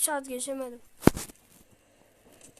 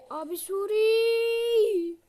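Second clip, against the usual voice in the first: higher, louder, very slow drawn-out call; long calling out; 6 semitones higher, 6 dB louder, much slower than usual. Real, not acted. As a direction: call out long across the distance. Calling character repeatedly.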